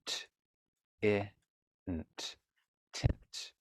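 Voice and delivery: "tint" split into T, I, nt, then whispered whole; sounding out tint. Shown as a demonstration. Teacher modeling how to sound out "tint" for a child. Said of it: "Let's try another one".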